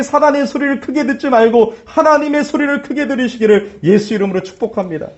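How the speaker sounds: ordinary talk, nothing marked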